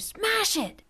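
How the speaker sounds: put-on very high shrill-free yell, different boy's voice; screamed again by another boy. A narrator's reading. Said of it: "Rusty and Vaco screamed".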